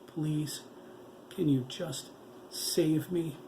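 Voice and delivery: a monster pleading with the doctor, voice strained; straining with emotion. A narrator's reading. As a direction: beg through held-back sobs. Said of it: "voice straining with the emotion".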